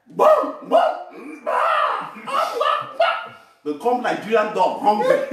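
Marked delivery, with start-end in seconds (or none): 0.1-3.3 high-pitched mock dog barking, a few short yelps; Australian dog barks. Preacher mimicking an Australian dog for the listeners, mock barking. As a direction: bark and howl high like a dog that soon gives up. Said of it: "Australian dog, just two times".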